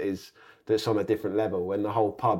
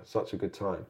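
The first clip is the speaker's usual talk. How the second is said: ordinary delivery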